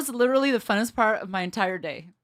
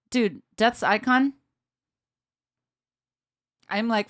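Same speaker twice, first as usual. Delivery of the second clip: ordinary delivery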